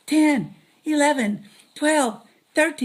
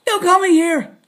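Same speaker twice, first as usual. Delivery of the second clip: irritated outburst; 5 semitones higher, 8 dB louder than usual. Acted. Phone-call interruption sketch.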